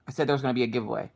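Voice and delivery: ordinary talk, nothing marked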